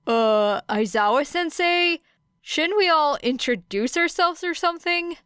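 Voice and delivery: put-on high, eager voice of a boy calling across the room; redhead calling out. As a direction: pitch it up and question them doubtfully. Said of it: "the redhead raised his hand".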